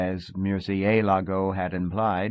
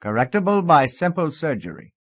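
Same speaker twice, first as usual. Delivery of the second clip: higher, louder put-on German accent; mock German accent; 7 semitones higher, 5 dB louder than usual. A narrator's reading. Husband playing doctor on simple surgery, German accent. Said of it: "German accent this time".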